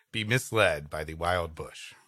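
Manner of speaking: normal talking voice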